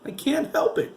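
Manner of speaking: high giggly voice, trying not to laugh; giggling. A narrator's reading. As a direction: squeak it out through giggles. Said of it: "Craig giggled".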